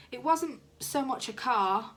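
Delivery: usual speaking voice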